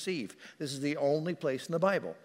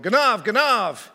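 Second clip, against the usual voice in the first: louder, higher, drawn-out warning cry; retold yell; 6 semitones higher, 9 dB louder, slower than usual. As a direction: re-enact yelling out at a thief. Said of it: "And I yelled".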